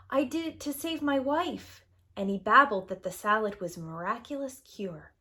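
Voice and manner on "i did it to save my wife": higher, desperate explanation; frightened plea. A narrator's reading. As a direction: plead for your life. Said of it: "he cried".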